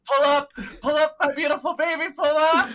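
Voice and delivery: high, loud mock-desperate urging; Sinister. Sinister watching his ship go down, hammed-up desperate cry.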